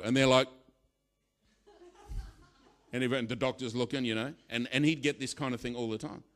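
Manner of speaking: ordinary delivery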